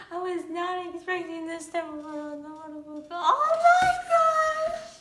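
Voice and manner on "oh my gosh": very high, loud, slow shriek; long shriek. Real, not acted. Fan seeing an unexpected photo, long high shriek. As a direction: let it out in one long delighted scream.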